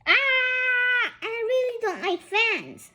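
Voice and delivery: high crying shout; panicked scream. Acted. Fleeing the snake, high panicked scream. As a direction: scream in terror.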